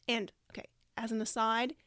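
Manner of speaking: ordinary talk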